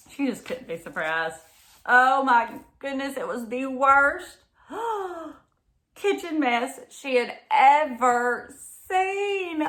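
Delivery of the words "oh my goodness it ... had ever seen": shocked. Acted. Mama sees the kitchen mess.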